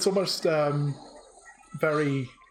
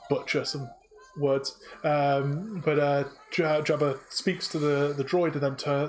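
ordinary delivery

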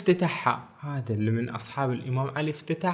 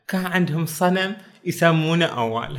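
ordinary talk

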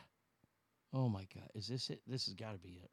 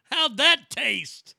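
drawn-out, louder ad quote; slow mock Flair line; 7 semitones higher, 6 dB louder, much slower than usual. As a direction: stretch it out like Flair.

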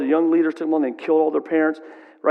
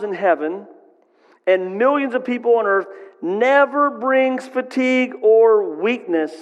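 higher, slow and emphatic preaching; 6 semitones higher, slower than usual. Acted. Preacher proclaiming God's power to the congregation, slow and emphatic. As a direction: lean into each phrase and slow down.

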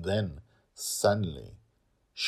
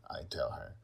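plain reading voice

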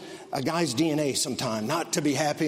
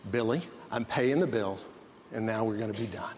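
lower, softer soothing dad voice; calm dad; 6 semitones lower, 5 dB quieter than usual. Retold or imitated. Dad calmly reassuring his son Billy in the store. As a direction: soothe a fussy kid evenly. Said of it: "Dad is as calm as a cucumber".

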